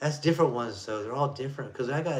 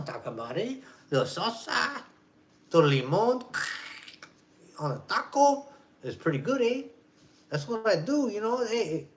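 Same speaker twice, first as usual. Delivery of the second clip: higher mock Mexican accent about tacos; 9 semitones higher than usual. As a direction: keep the hammed-up Mexican accent going.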